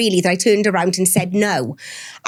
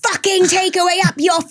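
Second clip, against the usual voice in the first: higher, raised mock scream of fury; retold scream; 9 semitones higher than usual. Exhausted wife screaming at husband over the takeaway, mock fury. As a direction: replay the furious scream, not full volume. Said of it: "I screamed".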